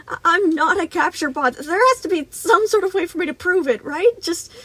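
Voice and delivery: high, loud, desperate pleading; panicked squeak. A narrator's reading. Boy begs to prove himself, high and frantic. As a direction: plead shrilly, stumbling to a stop. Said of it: "Gregory squeaked".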